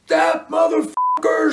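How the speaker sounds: high, angry scream; thrown-out groupie screaming to her friend outside. A narrator's reading. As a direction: spit it out in rage. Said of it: "she screamed".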